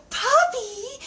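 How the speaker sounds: squealed puppy greeting; excited puppy call. Shown as a demonstration. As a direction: greet the dog with joy. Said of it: "the correct way ... is to go".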